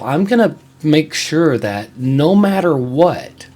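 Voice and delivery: ordinary delivery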